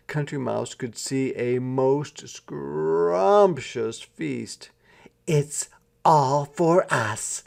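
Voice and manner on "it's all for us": proud. Acted. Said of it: "said City Mouse proudly".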